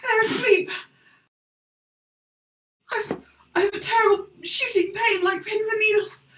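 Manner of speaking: high, whiny voice with a laughing sob; Kev whimpering. Acted. Kev moans to the party about numb legs, high whimper.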